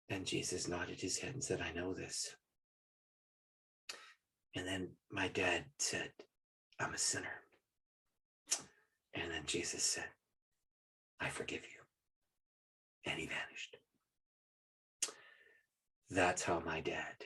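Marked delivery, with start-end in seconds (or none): 1.6-13.8 softer, nearly whispered; hushed awe. Real, not acted. Telling the audience of Jesus forgiving dad, hushed. Climax of the vision. 16.1-17.1 hushed. Real, not acted. Reverent aftermath.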